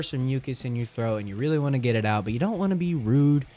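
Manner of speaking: usual speaking voice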